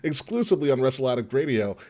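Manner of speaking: upbeat announcer promo; podcast promo read. Acted. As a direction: sell the episode like a radio host. Reads show promo.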